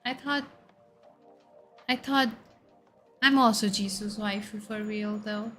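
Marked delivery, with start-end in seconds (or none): none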